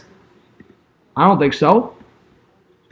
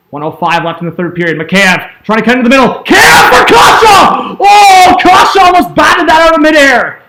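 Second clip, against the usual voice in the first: live play-by-play; 2 semitones higher, 4 dB louder than usual. Real, not acted. Fan calling the final minute for viewers, play-by-play. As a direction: call it like a broadcaster getting swept up.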